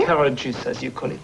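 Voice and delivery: put-on French accent. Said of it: "I love that French accent".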